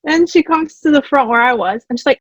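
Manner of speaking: usual speaking voice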